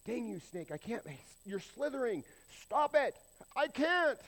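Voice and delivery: high, loud, whiny snake voice; a whining snake pleading it can't help it; mock snake. Mock snake dialogue, comic.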